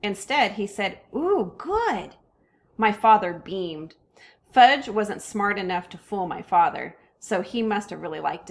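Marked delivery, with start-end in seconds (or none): 1.1-2.1 put-on high little kid delight; a small child savoring food; toddler voice. A narrator's reading. Toddler Fudge tasting the omelet, in a high kid voice.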